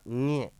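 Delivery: ये as a held nasal nya; nasal pronunciation. Shown as a demonstration. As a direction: model ये as the palatal nasal, nya. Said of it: "इसको क्या बोलेंगे?". (ये को नाक से "ञ" जैसा खींचकर बोलें)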